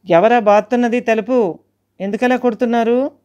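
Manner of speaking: scared shout. Retold or imitated. Shouting at the banging door.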